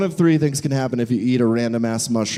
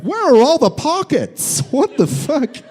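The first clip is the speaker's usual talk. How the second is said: put-on confused teen; 11 semitones higher, 3 dB louder than usual. Teen confused by khaki pants.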